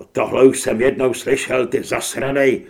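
very loud, furious retort; angry dismissal. A narrator's reading. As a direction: throw the plea back with disgust. Armed narrator rejects Grant's plea. (vzteklé odseknutí)

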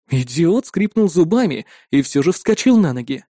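usual speaking voice

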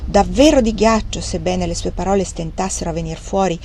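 usual speaking voice

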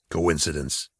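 low, soft mutter. A narrator's reading. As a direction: mutter it dismissively in a deep voice. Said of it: "Nail muttered".

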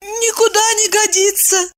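very high, submissive voice; meekly agreeing wife. A narrator's reading. Wife agrees with ranting husband, meek. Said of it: "согласилась она".

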